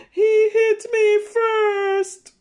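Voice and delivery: put-on high, slow childish whine; whiny kid voice. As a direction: whine it like a little boy blaming his brother. Child voiced in picture book.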